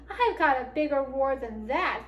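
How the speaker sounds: ordinary delivery, nothing marked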